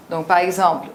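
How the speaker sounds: usual speaking voice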